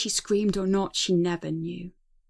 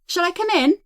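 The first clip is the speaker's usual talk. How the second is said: higher, louder cheerful question; cheery; 6 semitones higher, 6 dB louder than usual. Acted. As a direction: ask brightly through the door. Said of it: "speaking cheerily".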